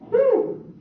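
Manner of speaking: high, loud whistling sigh of relief; relieved whew. Retold or imitated. Comic tells blind date his relief with a high loud whew. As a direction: blow out a big mock-relieved whew.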